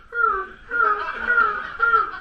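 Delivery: loud panting dog noises; dog bark. Retold or imitated. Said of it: "pretend that you're the dog, you just go".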